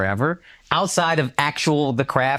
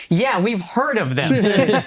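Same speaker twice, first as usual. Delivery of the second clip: slow, higher, casually understated; offhand understatement; 7 semitones higher, much slower than usual. Retold or imitated. Wife telling the rich girl, coolly downplaying. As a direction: shrug off a famous name like it's nothing. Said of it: "she's saying it like we haven't heard of it".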